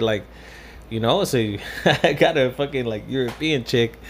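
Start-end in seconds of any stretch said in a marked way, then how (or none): 1.4-2.0 brief real chuckle mid-story; short laugh. Real, not acted. Man amused by his own dating story, short laugh. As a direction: let out a quick laugh.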